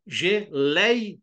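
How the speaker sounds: GELEIA slowly split, LEI strong and falling; falling diphthong. Shown as a demonstration. Teacher demonstrating a falling diphthong, "geleia" slowly syllabified. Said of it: "O E começa alto e cai pro I".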